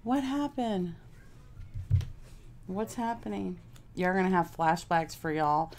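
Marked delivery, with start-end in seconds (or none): 0.0-3.6 imitating her toddler yelling, much higher; mock toddler yell. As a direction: play a two-year-old barging in. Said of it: "yelling in there and be like".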